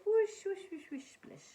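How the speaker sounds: high playful whoosh fading to a whisper; imitating a little waterfall; water whooshing. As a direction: make the water sounds for the kids.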